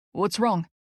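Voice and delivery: ordinary delivery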